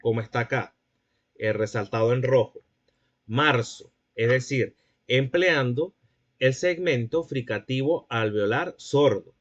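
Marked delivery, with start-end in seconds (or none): 3.3-3.9 "Marzo" with a plain s sound, marso; "Marzo" pronounced marso with an s; seseo Latin American s. Shown as a demonstration. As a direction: say "Marzo" with an alveolar s. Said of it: "lo va a pronunciar de esta manera".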